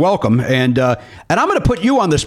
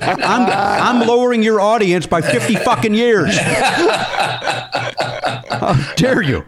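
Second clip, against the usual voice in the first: mock outrage; 7 semitones higher, slower than usual. Mock offended host.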